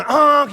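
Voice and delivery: loud, high mimicked honk; car horn imitation. People behind a stalled car, mock honking. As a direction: honk like an impatient driver behind. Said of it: "and people go".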